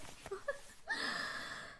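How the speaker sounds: high, airy gloating cackle. Acted. Fiancée gloats over the beaten girl in the ring, cackling. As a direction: cackle over the fallen fighter. Gloating after knockout count.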